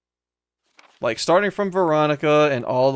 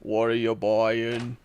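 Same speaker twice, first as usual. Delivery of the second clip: slow, synthetic robot merchant voice; 2 semitones lower, 3 dB quieter, slower than usual. Pretend. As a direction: speak it like a sentient robot. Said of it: "have a robotic sentient voice just say that".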